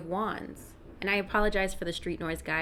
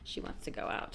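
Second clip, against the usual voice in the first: whispered aside; hushed, breathy half-whisper; 10 dB quieter than usual. Real, not acted. Aside while dog interrupts.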